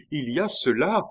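high, loud repeated cry; insistent exclamation. A narrator's reading. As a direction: cry out, pitch and volume jumping up. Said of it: "s'écria-t-il".

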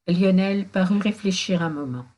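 plain reading voice

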